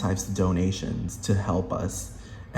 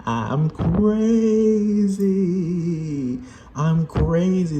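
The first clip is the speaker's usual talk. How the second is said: high, slow, louder unhinged wail; mock crazy man; 8 semitones higher, 4 dB louder, much slower than usual. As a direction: stretch it out like a madman. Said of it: "vibes you're getting".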